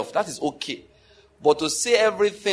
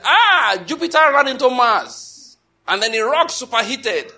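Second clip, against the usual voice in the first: high, loud mock exclamation; loud, hammed-up discovery; mock realization; 6 semitones higher, 6 dB louder than usual. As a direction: blurt it as an absurd eureka moment. Mock voicing of absurd conclusion.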